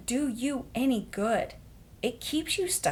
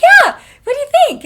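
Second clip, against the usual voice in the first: much higher, loud gushing squeal; squealing excitement; 17 dB louder, faster than usual. Retold or imitated. Pitching the name Gap to Christina, squealing. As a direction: gush as if it's the best name ever. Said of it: "I'm like".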